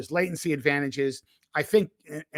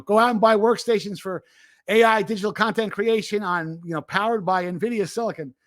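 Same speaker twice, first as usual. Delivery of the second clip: excited pitch; 5 semitones higher, 5 dB louder than usual. Retold or imitated. Retelling Jensen's pitch to partners.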